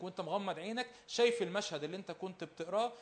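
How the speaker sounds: usual speaking voice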